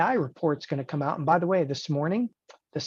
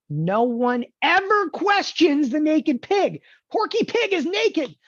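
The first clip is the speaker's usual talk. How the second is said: mock solemn; 10 semitones higher, 4 dB louder, slower than usual. Joking about Porky Pig.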